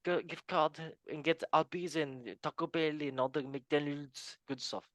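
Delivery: hammed-up French accent. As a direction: call the scores in a put-on French accent. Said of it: "We can go to France accent".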